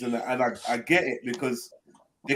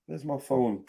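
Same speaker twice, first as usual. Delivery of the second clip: ordinary talk, nothing marked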